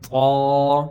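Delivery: loud held طا with a rounded vowel; full-mouthed heavy t in طا; thick, heavy طا. Shown as a demonstration. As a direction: fill the mouth and stretch طا. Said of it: "اوپر تا باریک ہے".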